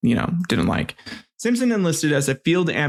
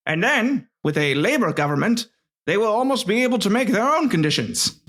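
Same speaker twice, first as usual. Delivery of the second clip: put-on higher, theatrical old-fashioned letter reading; old-timey voice; 5 semitones higher than usual. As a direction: read it like an old-timey gentleman. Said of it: "more of an old-timey voice".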